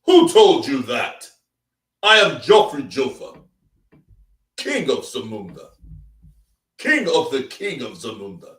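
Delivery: lightly played haughty king of Zamunda; King Joffrey voice. Streamer playing the king demanding who told them, pompous. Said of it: "sounds like King Joffrey".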